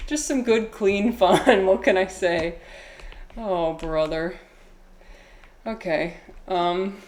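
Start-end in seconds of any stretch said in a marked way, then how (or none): none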